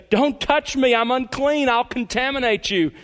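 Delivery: a touch of a desperate outcast warning others away; lightly voiced leper. Preacher voicing a leper warning people off, mild pleading. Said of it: "like the leper who had to say".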